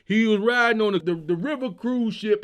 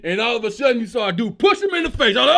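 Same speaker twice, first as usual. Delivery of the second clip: normal talking voice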